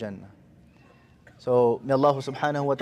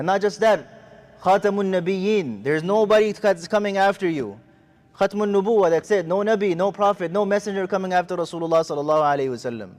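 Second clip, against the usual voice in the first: preaching with force; 4 semitones higher than usual. Real, not acted. Preacher presses the point to the students, forcefully. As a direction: drive the point home to the congregation. Preacher stresses the final prophethood.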